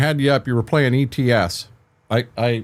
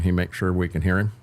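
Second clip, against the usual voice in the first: ordinary delivery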